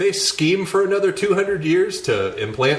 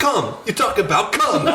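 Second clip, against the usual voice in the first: laughing; 7 semitones higher than usual. Real, not acted. Laughing at lewd innuendo.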